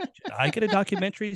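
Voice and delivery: lightly voiced sarcastic grandfather; understated sarcastic quip; mildly sarcastic. A narrator's reading. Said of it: "said Arnold in his usual sarcastic".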